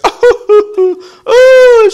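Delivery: very high, loud wailing sobs; hammed-up crying howl; mock bawling. Son mimics mom crying back to the car, bawling.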